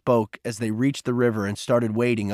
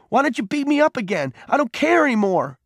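very high, reckless challenge; heated, desperate goading; angry dare; 12 semitones higher, 3 dB louder than usual. A narrator's reading. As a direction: provoke him, pitch pushed right up. Peter dares Cole to hit him.

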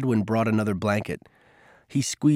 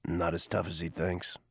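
low, soft whisper back; 5 semitones lower, 12 dB quieter than usual. A narrator's reading. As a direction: hush it, deep and quiet. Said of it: "Garvey whispered back".

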